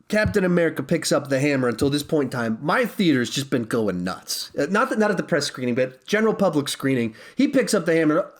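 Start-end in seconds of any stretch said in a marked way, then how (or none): none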